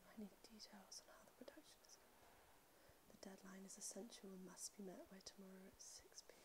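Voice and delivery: urgent unvoiced whisper; hushed secret instructions. Real, not acted. Whispered secret phone order.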